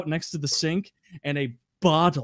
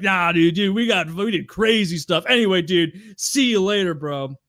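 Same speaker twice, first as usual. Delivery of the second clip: louder, higher carefree bro; imitating Andy; 4 semitones higher, 5 dB louder than usual. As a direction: wave it off casually. Andy dismissing narrator.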